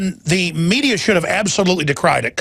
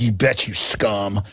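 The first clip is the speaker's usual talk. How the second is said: contemptuous officer; 8 semitones lower, slower than usual. Retold or imitated. Officer sneering at a citizen, low and slow. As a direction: growl it down at them, slowly. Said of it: "It's just like".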